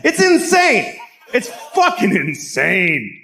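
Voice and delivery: high-pitched exasperated shout, then stretched out; worked-up, incredulous yell; outraged outburst. Acted. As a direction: cry out in comic disbelief. Outraged rant about the film's premise.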